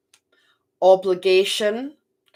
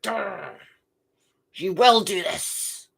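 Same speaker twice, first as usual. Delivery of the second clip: raging matron; slower than usual. Retold or imitated. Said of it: "Look at her, she's raging".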